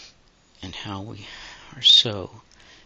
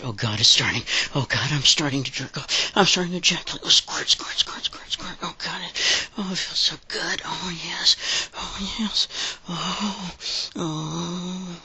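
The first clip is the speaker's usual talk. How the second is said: climax moans; 8 semitones higher than usual. Real, not acted. Climax, then fading afterward.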